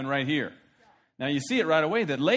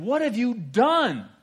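higher, slow, wounded accusation; indignant Laban; 7 semitones higher, 5 dB louder, slower than usual. Acted. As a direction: play the hurt victim.

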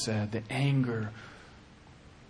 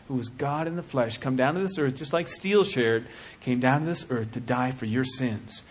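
usual speaking voice

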